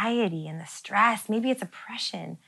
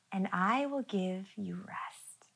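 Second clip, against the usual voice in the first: slow, softer whispered promise; 9 dB quieter, slower than usual. Retold or imitated. Jesus promising rest to the weary, slow soft whisper. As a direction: hush it like comforting someone. Quoting Jesus' gentle invitation.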